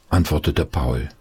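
plain reading voice

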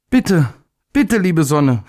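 high, urgent plea; little day calls up to the hidden sun; pleading little day. A narrator's reading. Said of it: "rief er".

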